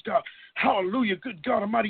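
normal talking voice

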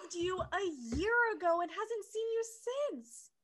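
loud, high, fed-up rant; exasperated rant. Real, not acted. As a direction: yell it out like she's had enough.

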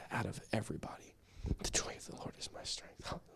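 hushed, voiceless whisper to oneself; whispered self-encouragement. Acted. Preacher facing someone he'd rather avoid, whispering scripture to himself. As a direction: whisper it under the breath. Muttering strength to self about difficult person.

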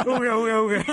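loud, high mock caveman grunts. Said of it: "y otros sonidos de Santa".

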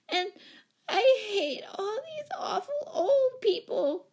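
very high, slow tearful roar; child's roar. A narrator's reading. Small Betsy rejecting the old people, roaring. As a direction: bawl it like a furious little girl. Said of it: "she roared".

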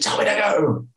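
high, insistent mock demand; angry demand. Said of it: "She was so angry".

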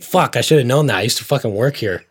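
snapping at himself for forgetting the hours; exasperated self-scolding. Retold or imitated. Retelling how he cursed at the closed shop, loud and exasperated.

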